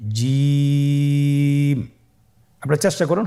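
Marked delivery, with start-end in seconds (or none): 0.1-1.8 জিম with the vowel held very long, slow and steady; drawn-out letter name. Shown as a demonstration. Teacher has the class repeat the letter after him, drawn out very long. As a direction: stretch জিম out over three alif lengths. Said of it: "আবার বলুন".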